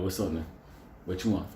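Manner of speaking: low, offhand counter greeting; curt city server. Acted. City fast-food server takes an order, curt and offhand.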